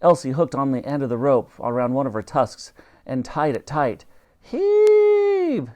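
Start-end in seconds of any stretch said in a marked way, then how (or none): 4.4-5.7 very high, drawn-out command; long high shouted order. A narrator's reading. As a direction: haul them into pulling. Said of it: "she ordered".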